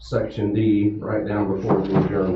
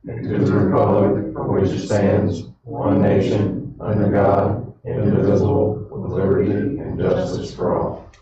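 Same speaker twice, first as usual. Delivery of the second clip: measured weighty baritone; 5 dB louder than usual. Acted. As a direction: recite the pledge like a chant. Pledge recited together, slow, loud.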